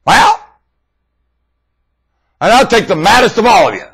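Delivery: loud, high preaching at full force; fiery preaching. Acted. Preacher challenging his listeners, full-force loud preaching. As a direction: hammer it home like a preacher on fire.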